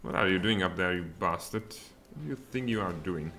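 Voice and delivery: ordinary talk, nothing marked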